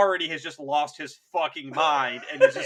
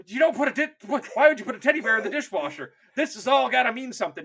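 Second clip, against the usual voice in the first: higher, faster, exasperated puzzling; baffled codebreaker; 6 semitones higher, faster than usual. Retold or imitated. Codebreaker baffled by the teddy bear message. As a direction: frantically try to decode nonsense. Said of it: "And it's like".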